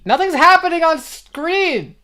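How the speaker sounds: high, loud exasperated outburst; exasperated protest. Real, not acted. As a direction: protest loudly, pitch leaping up. Objecting to request to pause stream.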